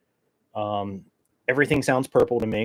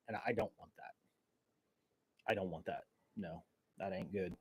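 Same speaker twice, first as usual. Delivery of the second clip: much softer, muttered under breath; quiet aside; 3 semitones lower, 18 dB quieter than usual. Real, not acted. Host backing off the weirdo act, very soft mumble. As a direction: drop to a low aside. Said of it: "I'll be that weirdo today".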